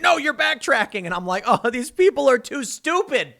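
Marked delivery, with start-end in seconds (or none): 0.0-1.0 lightly mocked, higher-pitched objection; mock accusers. Imitating Twitter critics who missed the joke, mock accusing. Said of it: "and they're like". 1.5-3.3 higher-pitched, lightly exasperated groan of complaint; mock exasperation. Recalling his reaction to online critics, mock exasperated.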